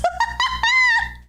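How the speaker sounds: high squealing cackle; high shrieking laugh. Real, not acted. Streamer shrieking with laughter at her own joke. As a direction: let out a shrill shriek of a laugh.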